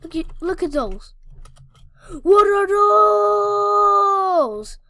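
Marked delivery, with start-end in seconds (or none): 2.2-4.8 slow, loud, high shout. Real, not acted. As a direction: stretch it out in a shout. Mocking Santa's outfit.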